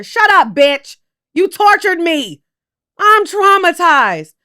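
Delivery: loud, high, slowing rant; angry outburst. Real, not acted. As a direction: yell it at him, wounded. Venting at regretful men.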